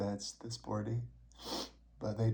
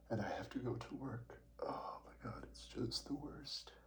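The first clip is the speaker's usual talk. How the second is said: whispered; 8 dB quieter than usual. Real, not acted. Dreading allergies at work.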